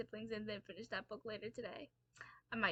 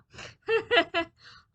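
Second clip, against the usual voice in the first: loud laugh; 8 semitones higher, 12 dB louder than usual. Real, not acted. Laughing at own sleep excuse.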